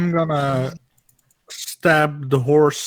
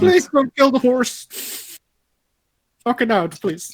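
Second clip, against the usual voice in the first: pitched-up begging, softening on the last please; urgent pleading; 9 semitones higher than usual. Real, not acted. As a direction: beg them to spare the animal. Pleading for the horse's life.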